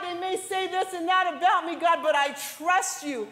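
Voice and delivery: rising preacher's fervor; preaching at full force. Acted. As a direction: cry it out to God from the pulpit. Preacher voicing a prayer.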